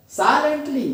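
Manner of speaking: "silently" as SI-lent-ly, high arch then fall; first-syllable stress. Shown as a demonstration. Teacher modelling the rule, stress on first syllable. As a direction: punch the SI of "silently". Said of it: "जोर देंगे".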